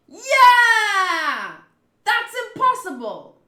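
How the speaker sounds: huge high yell, long first word; high, very loud outburst; shocked shout. Acted. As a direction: scream it in acted shock. Acted shocked crew reaction.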